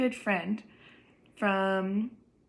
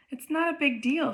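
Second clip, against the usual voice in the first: a touch defensive, pitched higher; mildly defensive; 6 semitones higher than usual. A narrator's reading. Olivia answering Justin about an old friend, mildly defensive. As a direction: brush it off defensively, voice lifted. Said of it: "she answers defensively".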